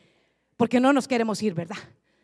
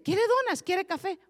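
sweet offer; 8 semitones higher than usual. Retold or imitated. Coworker offering donuts during fast.

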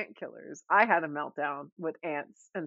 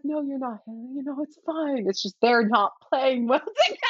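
reassuring mom; 6 semitones higher, 4 dB louder than usual. Retold or imitated. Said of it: "she's like".

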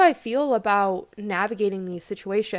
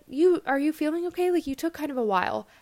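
higher, a hint of a concerned relative; worried family; 5 semitones higher, faster than usual. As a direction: lightly imitate family checking in on you. Family asking if she's okay.

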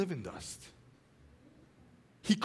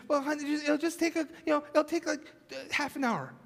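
lightly voiced wife, higher, playing it down; mock-sweet wife; 8 semitones higher than usual. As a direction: sweetly wave away the job as nothing.

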